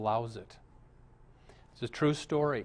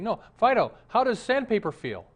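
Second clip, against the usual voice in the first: higher, louder prompting of a dog; eager dog owner; 9 semitones higher, 6 dB louder than usual. Acted. Man showing off his talking dog to theater manager, eager. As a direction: coax the dog to perform, raised voice.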